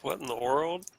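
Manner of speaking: incredulous. Acted. Player character seeing a horse float by, hushed incredulous question. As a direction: breathe it out in quiet amazement. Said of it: "I stare incredulously".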